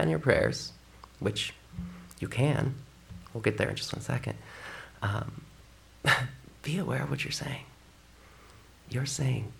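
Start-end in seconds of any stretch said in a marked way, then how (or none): none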